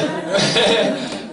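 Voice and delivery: a burst of real laughter at his own story; can't hold back a laugh; laughing out loud. Real, not acted. Preacher laughing at his own story.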